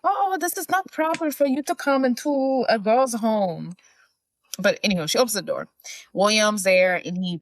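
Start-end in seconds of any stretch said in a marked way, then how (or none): 0.0-3.8 high, put-on Russian accent; hammed-up prim Russian-accented lady. She scolds William at the door, imitated Russian accent. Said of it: "can you say it in her accent".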